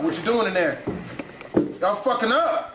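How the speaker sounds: ordinary delivery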